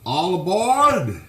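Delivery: long, loud, high conductor's call; drawn-out All aboard call, slow and loud. Shown as a demonstration. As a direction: belt it out like a train conductor. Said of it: "you have to do this".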